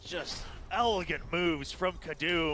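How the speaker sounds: loud, energetic caster recap; esports caster. Real, not acted. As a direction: hype the clutch in recap. Caster recap after round.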